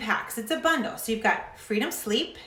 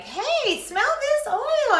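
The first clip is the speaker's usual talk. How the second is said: much higher, slow, sing-song offer; mock eager oiler; 3 dB louder, much slower than usual. Oiler pushing oil on a friend, high eager voice. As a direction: gush like a pushy oil lover.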